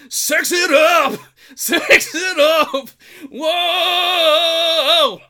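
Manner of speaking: high drawn-out shouting of sex it up; loud shout. Pretend. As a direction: belt it out high and slow, hammed up. Mock vocal warm-up, then sexy shout.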